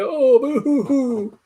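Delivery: high, slow mock boo-hoo; fake crying. Retelling how his fake crying on the stairs sounded, slow mock sobbing. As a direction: ham up a pretend sob. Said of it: "the fake crying".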